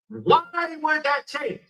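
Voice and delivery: slow, drawn-out, falling question; slow puzzled question. Acted. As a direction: let each word of the question hang, slowly. Repeats question, slow then sharp.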